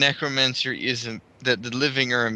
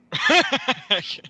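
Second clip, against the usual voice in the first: evil cackle; 11 semitones higher, 4 dB louder than usual. Acted. Spell cast, villain laugh.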